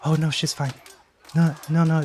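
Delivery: lifted, in-character reply; Robinson. Acted. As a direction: pitch up into a reassuring figure's voice. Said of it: "Robinson's in your ear saying".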